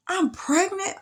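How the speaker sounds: high, stunned question; shocked. Retold or imitated. Young woman seeing the ultrasound, high shocked question. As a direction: let the shock lift the voice high.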